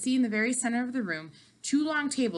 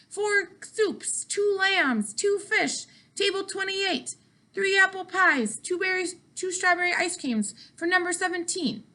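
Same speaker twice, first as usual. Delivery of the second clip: high, rapid-fire bellowing of food orders; yelled kitchen orders; 11 semitones higher, 3 dB louder, slower than usual. A narrator's reading. Waiter yelling orders to the cooks over the racket, loud and high.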